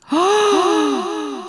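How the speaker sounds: high startled "Ah"; a sudden frightened breath of surprise; startled gasp. Acted. Fairy startled by the beetle's cry, high gasp. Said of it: "Da erschrak die Fee".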